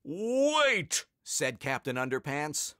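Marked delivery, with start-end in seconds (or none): 0.0-1.1 high, booming superhero cry; heroic shout. A narrator's reading. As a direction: call it like a cartoon hero. Captain Underpants commands.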